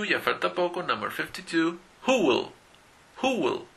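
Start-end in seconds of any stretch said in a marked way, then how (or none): 2.0-2.5 who will said huw ɪl, stress on who; teacher model of who will; who will, falling; model pronunciation. Shown as a demonstration. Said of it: "Who will go to the dentist". 3.2-3.7 who will said much higher, stress on who; raised model of who will; who will, high falling; model pronunciation. Shown as a demonstration. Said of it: "Who will go to the dentist".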